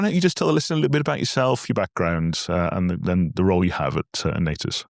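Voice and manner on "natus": ordinary talk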